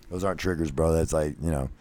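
usual speaking voice

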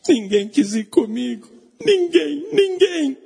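high-pitched acted wailing; hammed-up crying over being abandoned; tearful lament. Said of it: "E ficou chorando, chorando".

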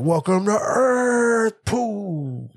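drawn-out, high action-hero catchphrase; Will Smith. Retold or imitated. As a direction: stretch it high, mimicking a movie hero's alien-punching line. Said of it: "he's like".

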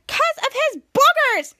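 high, loud, slow grossed-out whine; disgusted whine. Retold or imitated. Piper explaining to Jasper, high disgusted whine.

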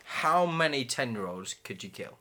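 ordinary talk, nothing marked

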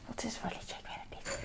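soft unvoiced whisper. Real, not acted. Whispering to herself about the song. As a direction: whisper it to yourself.

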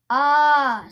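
loud startled ah. Acted. Goat boy reacting to the shrieking monster, loud ah. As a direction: blurt out a loud ah. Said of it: "said Duff".

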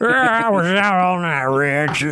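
lightly voiced slow hungover ballplayer; a hint of a groggy Babe Ruth; Babe Ruth impression. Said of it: "Lipton Brisk Iced Tea".

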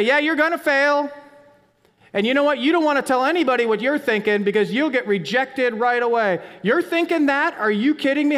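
high, loud, taunting accuser; accusing yell. Acted. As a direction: hammer out the condemnation as the enemy. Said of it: "he yells at us".

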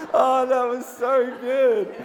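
high, loud, drawn out through laughter; laughing. Real, not acted. Preacher delighted by congregation's accent attempt, laughing. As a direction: gasp it out while cracking up.

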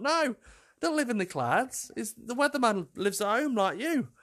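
retold high-pitched protest; incredulous correction. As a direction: put it high, as if correcting a small child. Retold protest to naive daughter.

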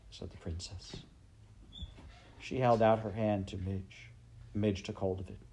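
usual speaking voice

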